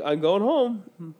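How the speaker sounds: slow drawl. Retold or imitated.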